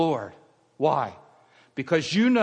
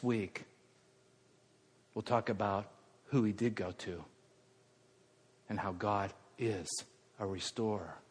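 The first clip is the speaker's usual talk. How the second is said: softer, lower, gentle pastoral voice; quiet intimate preaching; 7 semitones lower, 8 dB quieter than usual. Acted. Pastor speaking softly to the congregation, quiet preaching. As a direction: draw them in, almost confiding. Soft closing of sermon.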